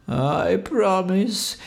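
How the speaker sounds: high, very slow, miserable promise; very sad voice. A narrator's reading. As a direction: whimper it out at half speed. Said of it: "he said in a sadder, sadder, sad voice".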